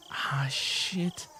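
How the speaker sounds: breathy panicked whisper under the breath; whispered curse. Acted. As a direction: hiss it so nobody hears. Panicked reader alone, cursing.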